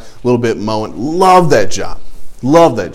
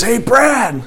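put-on old boss greeting; 10 semitones higher than usual. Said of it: "He says".

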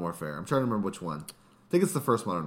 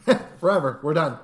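laughing; 6 semitones higher, 7 dB louder than usual. Real, not acted. Joking games never stop.

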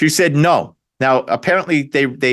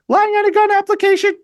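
high, squealed mock incredulity; mock disbelief. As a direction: shoot up high as if absurdly scandalized. Mocking excuses for Hunter's charges.

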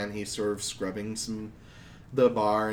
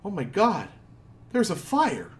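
alarmed cry; 9 semitones higher than usual. Acted. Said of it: "somebody says".

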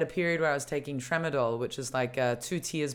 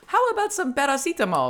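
high, louder, muddled mock-German accent; botched German accent; 11 semitones higher, 6 dB louder than usual. Joking that Germany hands out painkillers, botched German accent. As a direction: put on a badly faked German accent, pitched up. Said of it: "I don't know what that accent was".

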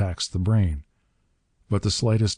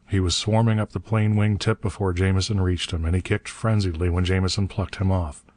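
ordinary delivery

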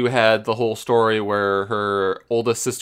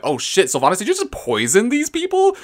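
shocked; 12 semitones higher than usual. Retold or imitated. Shocked reaction to poisoning.

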